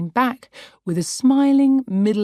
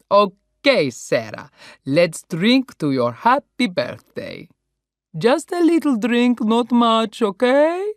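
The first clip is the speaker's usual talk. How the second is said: put-on loud, jovial Italian-accented toast; rally the table in Italian style; Italian host; 6 semitones higher, 5 dB louder than usual. Paolo raising a toast for the birthday, loud Italian accent.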